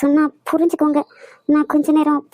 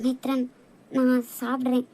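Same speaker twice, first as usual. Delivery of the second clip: soft and tender; 4 semitones lower, 9 dB quieter than usual. Acted. Wife speaks softly to husband.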